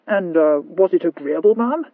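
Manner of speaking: courteous tipsy inquiry; polite. Acted. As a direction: ask the lady politely, a little drunk. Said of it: "inquired Sir Rowland politely".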